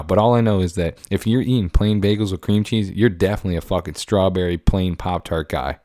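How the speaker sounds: normal talking voice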